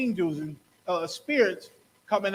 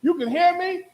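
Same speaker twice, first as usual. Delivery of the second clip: high, eager repeated question; the ghost overjoyed at being heard; 10 semitones higher, 5 dB louder than usual. Retold or imitated. Sam the ghost asking the psychic, high excited plea. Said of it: "he started talking like".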